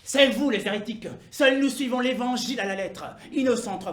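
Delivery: loud, insolent accusation; forceful, self-righteous declaration; impudent heretics. Acted. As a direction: throw the charge back brazenly. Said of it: "l'impudence et la prétention de nous dire".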